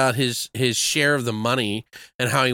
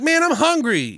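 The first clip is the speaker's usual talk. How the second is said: whiny. Retold or imitated. Jackson whining again.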